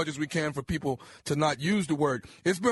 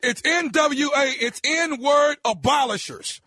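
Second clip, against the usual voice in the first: yelling, pitched way up; angry shout; 7 semitones higher, 4 dB louder than usual. Acted. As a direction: bark it at him, furious. Caller insisting loudly on name.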